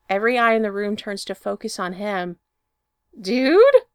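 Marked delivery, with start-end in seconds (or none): 3.2-3.9 sudden high-pitched shocked Dude; voice jumps up. Acted. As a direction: squeak the question up high and loud. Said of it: "Pete's voice goes up an octave".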